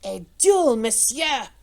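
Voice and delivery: put-on loud, furious French-accented outburst; choked wrath. A narrator's reading. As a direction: burst out with surging anger. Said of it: "choked in his throat by his surging wrath".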